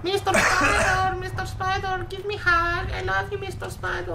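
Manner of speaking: put-on high-pitched Eastern European kid accent; little Eastern European child. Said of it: "little Eastern European child".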